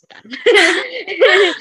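high, slow, loud half-laughing wail; laughing mock wail. Aunt to sticky-fingered nephew, a laughing high loud wail.

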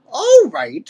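put-on high, loud old-ape concession; Cranky. A narrator's reading. Old ape sizing up the youngsters, shrewd. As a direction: relent in a high codger voice. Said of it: "over shrewdly".

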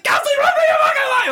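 very high, loud panicked shriek laughing through it; mock panic scream; screaming kid. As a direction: shriek it like a terrified little girl. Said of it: "She's like".

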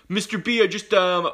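put-on high, loud, hesitant kid voice; student stalling to the teacher, mock nervous; mock stammering student. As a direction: imitate a kid with no excuse ready. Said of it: "they'll be like".

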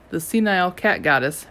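ordinary delivery

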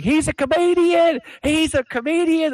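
very high-pitched imitation of wife; high, loud mock-frantic female voice; panicked wife. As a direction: plead over the uproar, hammed-up. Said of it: "my wife, she's like".

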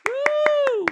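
high, loud whooping cheer; cheering the listener for getting it done; excited whoop. Real, not acted. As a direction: whoop like your team just scored. Celebrating listener doing the thing.

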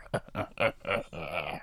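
evil chuckle. Acted. Villain laughs after transformation.

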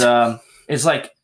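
usual speaking voice

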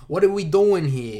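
New York accent. Shown as a demonstration. Showing a friend the accent, loud mock New York accent. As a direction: punch it out in exaggerated New York talk. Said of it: "That's the accent, I feel".

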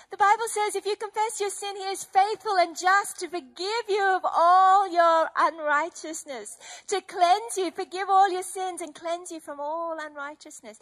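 high, loud, tearful scripture; fervent preaching. Acted. Preaching forgiveness to the church, fervent and tearful. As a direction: preach it with welling emotion.

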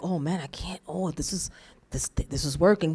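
low, quiet deflated muttering; confused enemy. Acted. Preacher as the thwarted enemy, confused and muttering. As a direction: grumble softly, plan falling apart. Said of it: "then it confuses the enemy".

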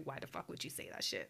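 hissed, unvoiced furious aside; whispered rage at her brother; angry whisper. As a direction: whisper it through clenched anger. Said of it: "She was like".

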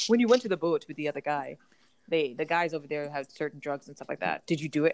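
put-on African accent. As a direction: mimic his African accent through the giggles. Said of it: "That's how he sounds".

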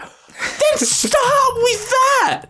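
very high, drawn-out exasperated yell; exasperated outburst. Retold or imitated. Friend to girlfriend on the phone, hammed-up exasperation. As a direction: let it explode in hammed-up frustration. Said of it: "I'm like".

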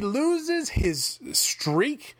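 slow, drawn-out "loses"; lamenting. Real, not acted. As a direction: mourn the lost streak slowly. Lamenting the Fiend's streak.

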